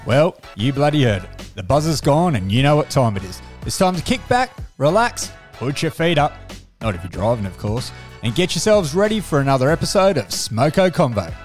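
loud booming announcer intro; show announcer. Acted. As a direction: hype up the show opening.

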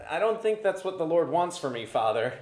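shy and a little embarrassed; sheepish. Retold or imitated. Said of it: "I sheepishly responded".

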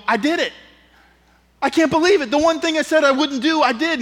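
high, loud, incredulous confession; acted disbelief. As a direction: confess it in high-pitched amazement. Acts out shocked self-admission.

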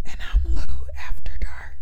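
breathy spooky whisper. Real, not acted. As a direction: whisper it like a late-night secret. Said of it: "'cause you're fucking whispering".

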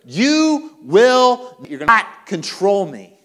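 loud, high defiance, drawn-out opening words; staring anger down. Acted. Preacher confronting anger to its face, loud defiance. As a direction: confront it forcefully, leaning on each word. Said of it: "stare anger in the face and say".

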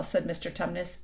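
ordinary delivery

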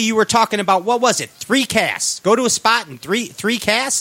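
ordinary delivery

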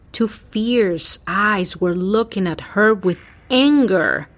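ordinary delivery

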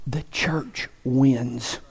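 hushed, unvoiced whisper of certainty; a whispered promise to the congregation. Real, not acted. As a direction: lean in and breathe it like a secret. Whispered climax of sermon.

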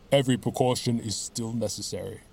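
plain reading voice

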